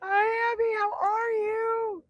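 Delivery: high, loud, gushing hello to a guest; excited greeting. Real, not acted. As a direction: greet her like you're overjoyed to see her.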